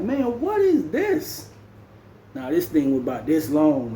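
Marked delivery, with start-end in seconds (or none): 0.0-1.5 high, slow, stunned question; drawn-out shocked voice; shocked disbelief. Retold or imitated. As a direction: recoil at the sight of it. Shown a rusty homemade blade.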